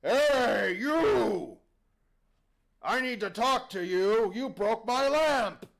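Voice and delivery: big, loud, drawn-out holler; booming genie. Acted. Genie looks down at the boy who broke his lamp, booming holler. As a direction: call down to someone far below. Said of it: "the genie looks down".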